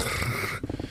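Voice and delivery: soft, voiceless throat rattle; failed rolled R that turns into a purr; almost a purr. Shown as a demonstration. Streamer attempting to roll the tongue, soft failed purr. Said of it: "I guess that was almost a purr".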